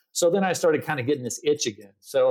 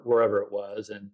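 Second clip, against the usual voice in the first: normal talking voice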